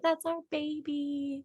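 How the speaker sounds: soft, high, drawn-out coo; hushed, slow and adoring; tender cooing. Retold or imitated. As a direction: coo it gently like a smitten new parent. Voicing the smiling parents-to-be, tenderly.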